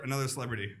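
ordinary talk, nothing marked

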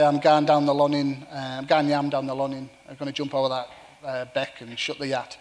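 broad Cumbrian dialect, loud; Cumbrian accent. Shown as a demonstration. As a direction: talk it like a real Cumbrian farmer. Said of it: "I can use my real Cumbrian voice".

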